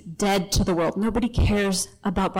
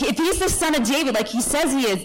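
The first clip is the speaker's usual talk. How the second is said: normal talking voice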